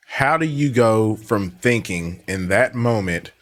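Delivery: usual speaking voice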